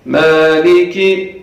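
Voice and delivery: slow مالکی with lengthened first vowel; long maa. Shown as a demonstration. Teacher drawing out مالکی for students. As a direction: stretch the maa in مالکی, kaf quick. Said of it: "الف صغیرہ ... کھینچ کے پڑھنا ہے".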